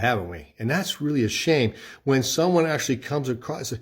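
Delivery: ordinary talk, nothing marked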